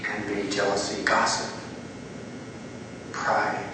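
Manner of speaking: soft, low, with long pauses; guided prayer. Real, not acted. Preacher asks Jesus to name each sin, hushed prayer. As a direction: lead them gently into silent reflection.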